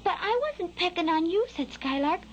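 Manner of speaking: high, protesting fledgling voice; young bird defending himself; little Skylark. A narrator's reading. Skylark explaining himself to the scolding worm, high protest.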